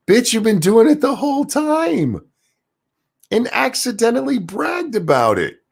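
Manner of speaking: very high-pitched indignant rant; high, strained-up tirade of disbelief; exasperated outrage. Acted. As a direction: wail the accusation way up high. Berating the host over his hypocrisy.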